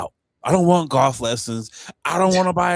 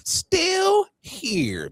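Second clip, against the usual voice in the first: mock meme voice. Repeats the meme line.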